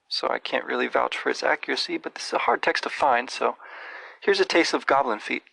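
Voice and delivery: ordinary talk, nothing marked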